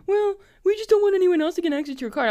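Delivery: high, fast patronizing agent; mock bank voice. The bank explains blocking her card, mock patronizing. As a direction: rattle off the bank's excuse. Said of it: "and then".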